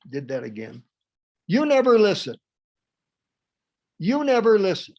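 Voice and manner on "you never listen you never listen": loud, high accusation; angry shout. Acted. Critical parent accusing the listener, angry shout. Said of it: "if you wouldn't shout at me".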